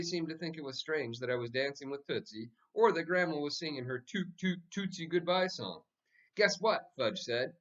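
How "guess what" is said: put-on high, eager little boy; bursting with news; excited kid. A narrator's reading. Fudge bursting to tell the family his news, eager high kid voice.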